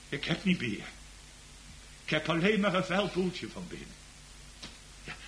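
usual speaking voice

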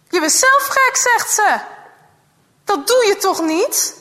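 you can't be serious, appalled; shocked girl. Acted. Girl reacts in shock to her friend's advice.

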